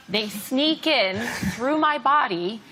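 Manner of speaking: ordinary talk, nothing marked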